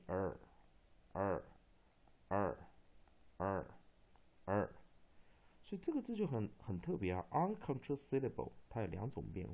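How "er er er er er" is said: the English vowel "er", written 二, five times separately; r-coloured long vowel. Shown as a demonstration. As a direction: say 二 as a curled-tongue "er", pausing after each. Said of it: "就全部都念二".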